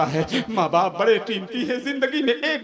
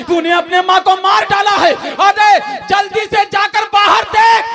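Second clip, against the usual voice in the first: alarmed shout; 9 semitones higher, 4 dB louder than usual. Retold or imitated. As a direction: yell at him to go look outside. Wife confronts drunk husband. (पत्नी की ऊँची, धीमी, चौंकी हुई चीख)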